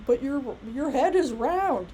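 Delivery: a student challenging the teacher; mock student objection. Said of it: "you know".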